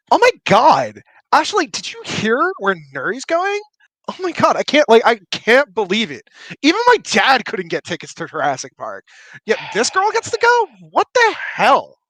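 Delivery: put-on high, gossipy Valley Girl voice; catty girl gossiping about Nuri outside the door, Valley Girl voice. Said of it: "my Valley Girl voice".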